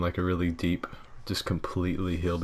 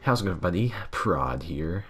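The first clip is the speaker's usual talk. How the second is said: Prod impression. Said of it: "my Prod impression".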